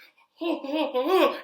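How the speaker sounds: high, loud mock whooping; imitated panicked noise. Mic man as wrestler is thrown out, whooping. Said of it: "he's like".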